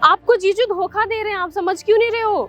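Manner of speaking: high-pitched, insistent protest; urgent pleading. Acted. Younger sister accusing brother-in-law before her sister, urgent pleading. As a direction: press her desperately to believe you.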